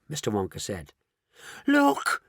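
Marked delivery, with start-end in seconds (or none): 1.6-2.2 scream. A narrator's reading. Old grandma spotting the monster, screaming in fear. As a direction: shriek it out in terror. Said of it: "screamed Grandma Josephine".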